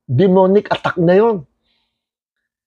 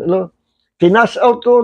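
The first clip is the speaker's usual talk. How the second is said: normal talking voice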